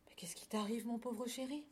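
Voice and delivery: soft, half-whispered worry; tender concern. A narrator's reading. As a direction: gently console a little one. Cow comforts the despairing lamb. (inquiétude tendre, à voix douce)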